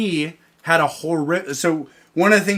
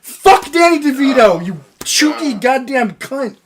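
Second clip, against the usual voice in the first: mock rage; 7 semitones higher, 7 dB louder than usual.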